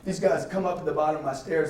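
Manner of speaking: normal talking voice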